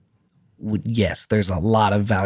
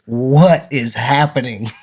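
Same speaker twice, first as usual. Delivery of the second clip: slow, louder mock disbelief; baffled passerby; 5 dB louder, slower than usual. Passerby overhears a sped-up podcast, baffled. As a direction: draw it out like a bewildered onlooker.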